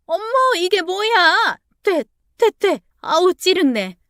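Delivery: high, shrill-pitched cry of disgust and shock; shocked shriek. Acted. Mother drenched by the in-law, high shocked shriek. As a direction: recoil as if drenched, voice shooting up high.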